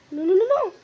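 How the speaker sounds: high, loud repeated no; despairing shout at the screen; loud panicked no. Real, not acted. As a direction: yell it as the goal goes in. Opponent about to score.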